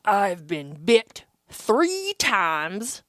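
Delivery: slow, low grim voice; a stranger bragging slowly and darkly; gruff old man. Retold or imitated. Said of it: "And he goes".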